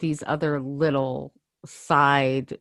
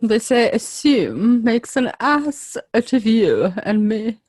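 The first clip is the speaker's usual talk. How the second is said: sophisticated mock-British delivery, slowing on the saying; put-on posh accent; 3 semitones higher than usual. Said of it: "like, sophisticated".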